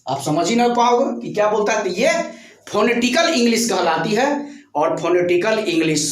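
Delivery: usual speaking voice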